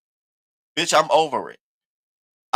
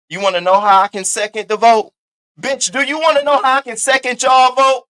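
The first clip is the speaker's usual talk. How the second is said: imitating Diva defiant; 6 semitones higher, 6 dB louder than usual. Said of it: "You know what Diva told them".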